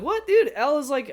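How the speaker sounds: high, loud incredulous outburst; outraged disbelief. Retold or imitated. Viewer scolds Mike for sending Elle away, outraged. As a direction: protest it like an exasperated viewer.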